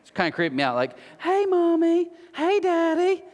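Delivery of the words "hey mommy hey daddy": put-on very high, sing-song little-kid voice; mocking grown-up daughters. Making fun of grown women greeting parents, mocking high voice. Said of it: "I'm making fun of them".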